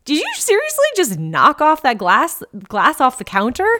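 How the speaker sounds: mild sassy friend ribbing; sassy buddy voice. Pretend. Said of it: "And I invite in this".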